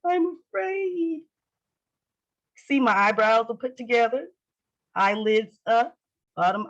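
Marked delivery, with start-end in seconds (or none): 0.0-1.2 high, slow mock-fearful whine; overdone scared voice, stretched out; afraid. Shown as a demonstration. Teacher showing a fearful face without laughing, mock afraid. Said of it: "fearful face I can make up".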